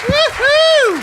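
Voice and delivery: high whooping cheer. Real, not acted. Host cheering the comic off stage, loud high woo-hoo.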